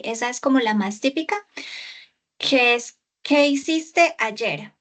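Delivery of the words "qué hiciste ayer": ordinary talk, nothing marked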